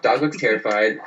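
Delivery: ordinary delivery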